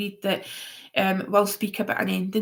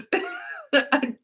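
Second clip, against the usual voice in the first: high laugh. Real, not acted. Laughing at the troll.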